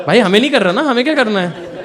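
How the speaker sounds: cracking up at the absurdity; laughing. Real, not acted. Laughing at own indifference. (हँसते हुए, ऊँची और ज़ोरदार आवाज़ में)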